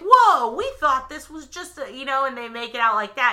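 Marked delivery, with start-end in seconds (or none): 0.0-1.9 mock surprise. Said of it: "they're like".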